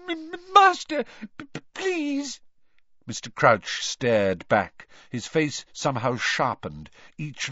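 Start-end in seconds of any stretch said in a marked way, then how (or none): 0.1-2.4 very high, pleading elf stammer; tearful stammering. A narrator's reading. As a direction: stammer out a desperate plea in a squeaky high voice. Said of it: "her eyes brimming with tears".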